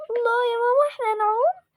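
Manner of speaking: high, eager cry of joy; excited child. Acted. Daughter telling mom they will swim, high and eager. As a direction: cheer like a kid about to swim.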